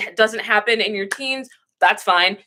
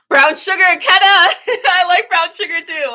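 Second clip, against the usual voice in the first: high, loud excited squeal; excited outburst; 10 semitones higher, 7 dB louder than usual. Real, not acted. Reacting to Keta about brown sugar, excited outburst. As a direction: burst out high with delight.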